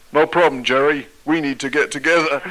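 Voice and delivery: put-on American accent. Ronnie unfazed on the phone with Jerry, American accent. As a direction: brush off the mix-up in an American accent. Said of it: "excuse the American accent".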